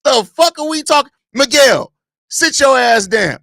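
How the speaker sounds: loud, heated rant at the fans; angry rant. Real, not acted. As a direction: yell at them like they've lost their minds. Ranting at fans.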